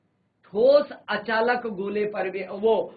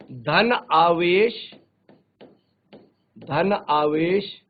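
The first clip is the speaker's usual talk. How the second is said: slow dictation; 3 semitones lower, much slower than usual. Shown as a demonstration. Teacher dictating notes to students.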